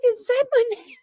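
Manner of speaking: high, excited mock squeal. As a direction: squeal it like a thrilled fan. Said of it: "He's like".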